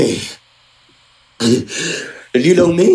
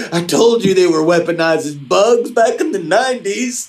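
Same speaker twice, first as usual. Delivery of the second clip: usual speaking voice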